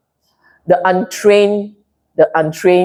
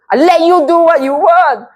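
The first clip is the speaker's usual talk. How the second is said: self-righteous; 9 semitones higher, 3 dB louder than usual. Retold or imitated. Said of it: "I'm being self-righteous".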